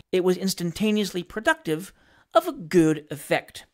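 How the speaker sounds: plain reading voice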